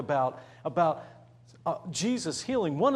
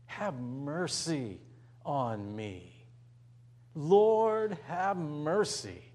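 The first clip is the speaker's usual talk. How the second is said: pleading; much slower than usual. Acted. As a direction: beg for mercy humbly. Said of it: "he adds these words".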